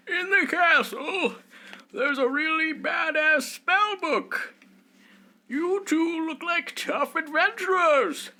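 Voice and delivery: high, slow, loud airhead wizard voice; old wizard. Acted. Game master voicing the wizard pitching a quest, airy and high.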